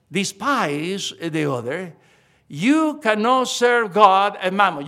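deliberate, loud ending of the verse; slow emphatic verse. Acted. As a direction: hammer home the verse slowly. Slow forceful scripture reading.

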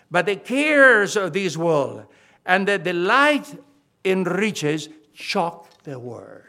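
loud, very slow scripture reading; preached scripture reading. Real, not acted. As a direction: weigh out each phrase of the verse forcefully. Reads scripture out from the pulpit.

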